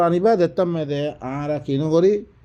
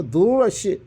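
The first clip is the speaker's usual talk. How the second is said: usual speaking voice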